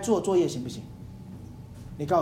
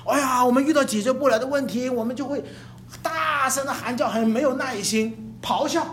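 normal talking voice

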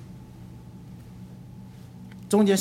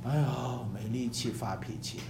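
much softer and lower, drained sigh of defeat; feeble moan; 9 semitones lower, 10 dB quieter than usual. Acted. Preacher plays a spent complainer moaning like a dove, softer and lower. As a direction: moan it out with no strength left. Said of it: "就像个鸽子哀鸣".

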